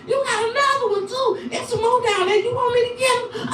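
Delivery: high, hushed girlfriend impression; young girlfriend voice. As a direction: offer sweetly like the young girlfriend.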